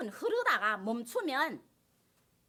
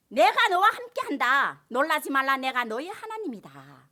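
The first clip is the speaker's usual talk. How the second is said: slow, louder solemn divine voice; voicing God; 8 dB louder, slower than usual. Pastor voicing God's promise to the congregation, slow and solemn. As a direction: speak as God comforting his people.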